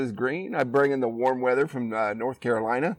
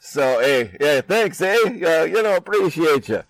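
loud, higher, hammed-up Canadian accent; attempted Canadian accent; 5 semitones higher, 7 dB louder, slower than usual. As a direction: put on a playful Canadian "eh" accent. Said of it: "what kind of accent that was".

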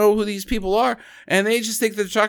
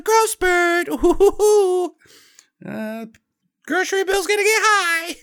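much higher, hammed-up hooting; mock teasing mom; 13 semitones higher than usual. Mom teasing her son about his growth, hammed-up high voice. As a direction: tease a growing kid in a high put-on voice.

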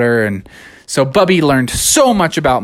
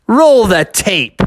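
triumphant cue; 8 semitones higher, 4 dB louder, slower than usual. Acted.

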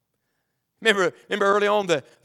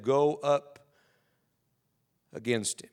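voice of God; 5 semitones lower, 7 dB quieter, slower than usual. Acted. God answers Israel in scripture.

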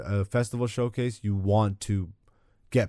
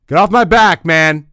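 loud, higher, playfully fed up; mock annoyed shout; 5 semitones higher, 12 dB louder than usual. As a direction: snap it out jokingly, loud. Mock annoyed outburst at listeners.

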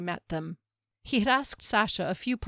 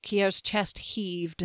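plain reading voice

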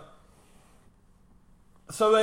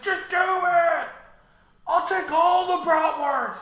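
mock heroic shout; 12 semitones higher, 4 dB louder than usual. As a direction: bellow it like an eager hero. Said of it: "He's like".

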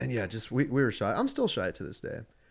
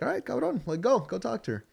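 high-pitched imitated goading; mock-impatient dad pushing his son; urging dad; 13 semitones higher than usual. Dad to shy son the girl picked, goading.

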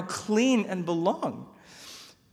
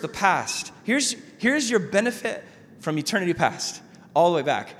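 normal talking voice

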